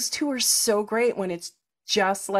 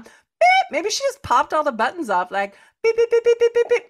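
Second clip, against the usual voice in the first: popping button beeps; 10 semitones higher than usual. Retold or imitated. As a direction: squeak out little beeps as each button pops.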